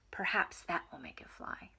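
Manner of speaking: whispered hopeful aside. A narrator's reading. Frog whispering a hopeful idea to Toad, hushed. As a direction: breathe it like a secret.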